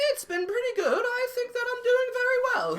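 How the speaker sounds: high-pitched put-on British accent; mock-British falsetto impression; Meghan Markle. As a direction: answer in a high, fake British voice. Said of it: "does his Meghan Markle impression, he puts on a British accent".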